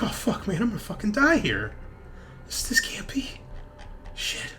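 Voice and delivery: high-pitched, stunned dread; doomed, disbelieving, fading out; despairing henchman. Acted. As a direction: voice up high, thinning to a whisper. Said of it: "doesn't know what to say or do".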